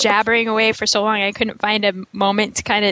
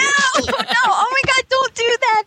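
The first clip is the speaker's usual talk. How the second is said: shocked protest; 12 semitones higher than usual. Real, not acted. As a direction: yell it out, appalled. Protesting a crude joke.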